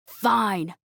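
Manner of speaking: ordinary delivery, nothing marked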